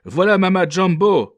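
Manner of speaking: high, loud cry of alarm; women crying out. A narrator's reading. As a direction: shout it high like frightened women. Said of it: "Les femmes criaient". (cri aigu des femmes)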